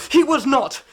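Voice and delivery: sharp loud retort, pitch up; angry denial. Acted. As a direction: fling it back at the accuser. Son defends father against insult.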